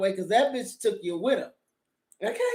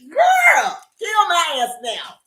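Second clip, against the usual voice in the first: high, loud horrified cry; horrified shout; 10 semitones higher, 6 dB louder, slower than usual. Real, not acted. Telling the viewers about the gross scene, a loud horrified shriek. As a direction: shriek it in disgust.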